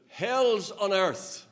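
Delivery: loud, high, slow bellow; retold heckler's shout. Heckler interrupting the open-air preachers, shouting slowly. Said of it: "he shouted".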